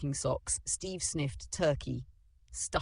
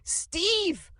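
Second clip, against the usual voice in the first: very high, loud happy greeting; joyful yell; 6 dB louder than usual. Acted. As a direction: hail him like an old friend. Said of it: "yelled like he already knew him".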